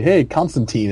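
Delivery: put-on last syllable of "Constantine" as TEEN; teen pronunciation. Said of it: "it'll be spelled T E E N".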